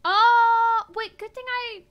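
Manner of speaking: loud high sudden cry; startled outburst. Real, not acted. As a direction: blurt it out in alarm. Sudden realisation.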